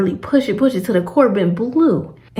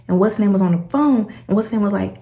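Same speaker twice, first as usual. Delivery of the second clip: ordinary talk, nothing marked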